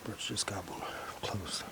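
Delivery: hushed whisper near game. Real, not acted. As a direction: whisper so the birds can't hear. Near turkeys, whispering not to spook.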